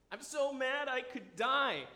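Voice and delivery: put-on high, loud, slow whining like a three-year-old; toddler tantrum. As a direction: throw a slow, pouting tantrum like a small child. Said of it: "Sounds like my three-year-old kid".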